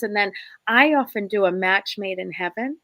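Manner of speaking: ordinary talk, nothing marked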